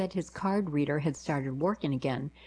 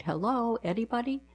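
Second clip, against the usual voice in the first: mock yell; 7 semitones higher than usual. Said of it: "but the yelling continued".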